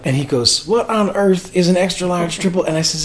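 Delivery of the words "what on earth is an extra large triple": put-on higher-pitched Memphis accent, bewildered. Singer at the drive-thru baffled by the order, imitated Memphis accent. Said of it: "in his Memphis voice".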